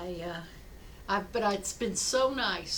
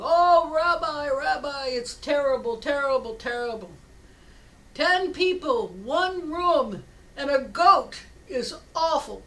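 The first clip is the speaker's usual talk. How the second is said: loud, high, hammed-up misery; acted wailing lament; 5 semitones higher, 8 dB louder than usual. Villager telling rabbi life with goat is worse, mock wailing. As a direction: wail each complaint in mock agony.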